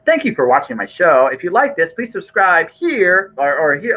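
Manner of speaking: hammed-up 'like and subscribe' read; mock YouTube outro.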